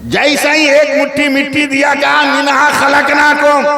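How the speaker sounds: impassioned high-pitched proclamation; full-force preaching. Real, not acted. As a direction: ring it out to the whole gathering.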